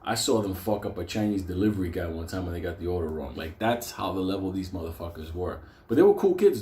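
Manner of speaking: ordinary talk, nothing marked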